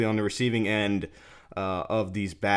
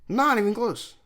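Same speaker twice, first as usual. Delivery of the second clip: incredulous outburst; 12 semitones higher than usual. Real, not acted. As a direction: exclaim it way up high in disbelief. Outraged reaction to the replayed call.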